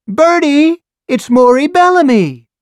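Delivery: high, loud shrill-pitched call into the next room; high loud excited yell; yelling to husband. A narrator's reading. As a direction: call out to Bert in the living room. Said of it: "and yelled".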